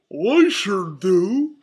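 loud, slow, swooping goofy shout. A narrator's reading. Volunteering to help fly the balloon, loud goofy shout. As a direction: holler it like a big silly cartoon dog. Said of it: "shouted Goofy".

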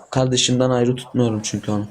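normal talking voice

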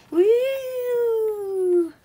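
long, high, loud whoop; excited whoop. Pretend. Toy delighted at finding money, long excited whoop. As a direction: whoop it out, sliding slowly down.

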